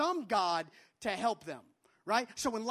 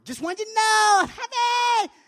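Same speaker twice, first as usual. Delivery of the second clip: put-on very high, loud shouted child voice; a small girl bursting with joy; excited little girl; 12 semitones higher, 15 dB louder than usual. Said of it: "has run up to me and said".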